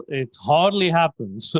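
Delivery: wry, facetious denial. Real, not acted. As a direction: say it jokingly, a laugh right after. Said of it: "You're being facetious there".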